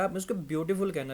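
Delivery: ordinary talk, nothing marked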